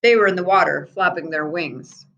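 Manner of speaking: ordinary delivery, nothing marked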